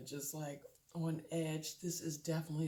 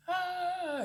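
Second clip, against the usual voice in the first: sharp high scream from the movie; mock movie scream; imitated scream; 7 dB louder than usual. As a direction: let out a short shrill shriek. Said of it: "then you hear this".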